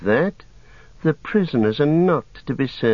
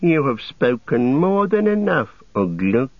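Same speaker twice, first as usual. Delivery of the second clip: higher, mocking orc voice; sneering evil orc; 6 semitones higher than usual. A narrator's reading. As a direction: sneer it with smooth disdain. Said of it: "sneered the evil voice".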